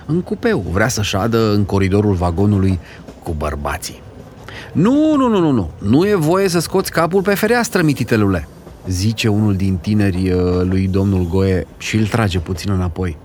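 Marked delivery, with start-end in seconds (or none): 4.7-8.5 put-on high, quick nu nu nu to a child; young man warning. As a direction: stop the child kindly but firmly. Young man stops the boy.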